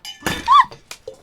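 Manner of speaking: loud startled gasp; sharp gasp. Real, not acted. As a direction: gasp in sudden alarm. Water glass tipping over.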